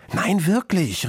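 put-on high-pitched girl protesting; insistent child's cry. A narrator's reading. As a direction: insist that it is true. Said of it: "rief das Mädchen".

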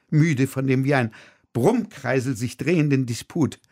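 usual speaking voice

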